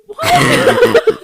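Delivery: high, loud, laughing what; laughing shocked what; laughing disbelief. Real, not acted. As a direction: shriek it in amused shock.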